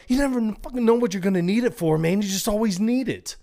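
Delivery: high, loud, fast rant; heated insisting. Retold or imitated. Connor defending the rope to Murphy, high, loud and rushed. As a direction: insist it like it's obvious, talking over him.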